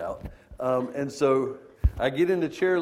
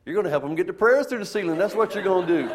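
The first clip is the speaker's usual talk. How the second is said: raised, quicker voice for the Lord; the Lord answering; 7 semitones higher, 6 dB louder than usual. Retold or imitated. The Lord answering the scared preacher, raised and quick. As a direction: play God replying with a wry push. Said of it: "like the Lord saying".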